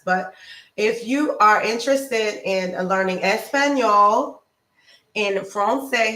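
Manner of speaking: ordinary delivery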